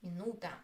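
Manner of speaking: "Minuta" stressed on the middle syllable, nu. Shown as a demonstration. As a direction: lean on the nu of "Minuta", pitched low. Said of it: "The stress is on U".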